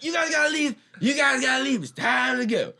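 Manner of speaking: high, loud mock yell at the guests; retold yelling. Kicking drunk friends out.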